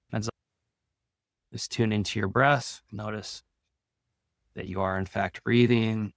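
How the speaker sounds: calm, soothing meditation read with long pauses; guided meditation. Acted. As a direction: ease listeners into their breath, unhurried.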